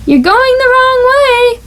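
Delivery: high teacher's call. A narrator's reading. Miss Witherspoon calls that Froggy goes the wrong way. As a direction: call out a gentle correction.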